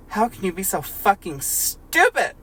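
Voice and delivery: a hammed-up angry outburst. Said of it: "Or raised his voice".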